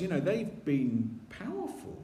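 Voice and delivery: ordinary delivery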